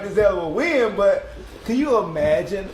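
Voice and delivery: usual speaking voice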